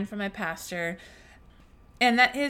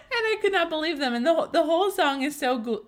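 laughing through tears; 5 semitones higher, 3 dB louder than usual. Real, not acted. Overcome recalling the song lyrics.

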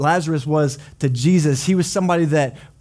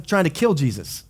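ordinary delivery

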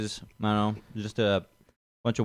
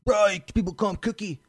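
high-pitched mock explaining voice; put-on high voice; imitated bystanders; 12 semitones higher than usual. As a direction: mimic friends butting in, pitched way up. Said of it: "then people be like".

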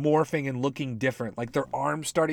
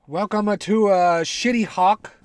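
mock show host; 6 semitones higher, 7 dB louder than usual.

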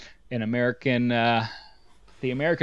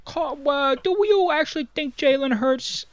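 squeaky excited Delco caller; sports radio caller; 12 semitones higher than usual. Pretend. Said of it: "For caller, we got you on the line".

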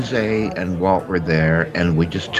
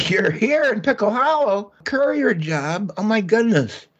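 much higher, delighted voice; thrilled phone greeting, pitched way up; excited; 12 semitones higher than usual. Acted. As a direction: gush with joy at hearing an old friend. Delighted call from old friend.

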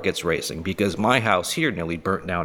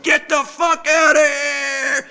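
high, slow furious yell; mock furious friend yelling; angry shout; 4 dB louder, slower than usual. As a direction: bellow him out of the house. Angry friend voiced in story.

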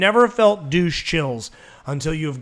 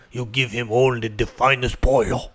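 put-on low, grave Mexican accent; mock Mexican waiter; 6 semitones lower than usual. Waiter instructing the kitchen, low hammed-up Mexican accent. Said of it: "a waiter going to the back and be like".

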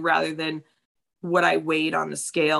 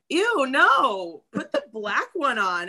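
a hint of a bossy friend, slightly higher; imitating teammate; 6 semitones higher, slower than usual. Teammate rejecting her shirt, mild mock disgust. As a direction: lightly imitate a blunt friend's verdict. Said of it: "she was like".

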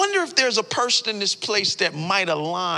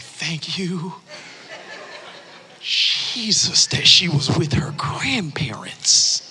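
slow whispered thanks; relieved whisper; 3 semitones lower, much slower than usual. Retold or imitated. Father whispers thanks to Jesus, slow and relieved. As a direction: breathe out the relief to God. Relief after finding daughter.

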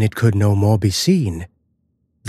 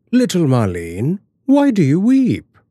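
put-on raised pitch, gently coaxing a child; lightly voiced concerned father; 8 semitones higher, 4 dB louder than usual. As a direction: ask the weeping child with mild concern. Father questions weeping child.

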